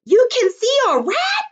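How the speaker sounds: high, loud shriek of fright; high, loud scared yell at seeing a rat. Pretend. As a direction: shriek it as if you saw the rat. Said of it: "I would be yelling it".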